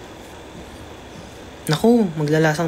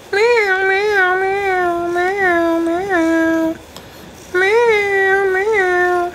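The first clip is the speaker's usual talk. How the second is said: very high, loud meowing like an angry cat; high-pitched angry cat impression; imitating the cat; 4 dB louder, much slower than usual. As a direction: meow back at the cat, mimicking her anger. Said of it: "ginagaya ko siya".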